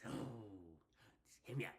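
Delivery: low, soft lead-in; hushed and low; soft plea. Real, not acted. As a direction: quietly ask for a hearing. Repeats plea, building.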